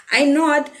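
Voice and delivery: ordinary delivery, nothing marked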